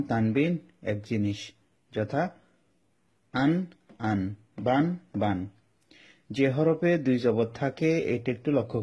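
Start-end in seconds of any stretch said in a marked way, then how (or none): 3.3-5.5 আন, আন drawn out very slowly; slow demo. Shown as a demonstration. Teacher repeating tanween sounds slowly for the class. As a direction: stretch each আন and বান carefully. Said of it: "আমি আরেকবার বলে দিচ্ছি".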